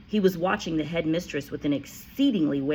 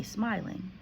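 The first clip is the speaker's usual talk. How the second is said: ordinary delivery, nothing marked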